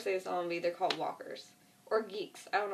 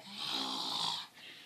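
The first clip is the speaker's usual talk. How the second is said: long, low rasping gasp; imitated zombie gasp; 5 dB quieter than usual. Imitating a rotting body reaching out, low gasping rasp.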